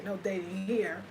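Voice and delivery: ordinary talk, nothing marked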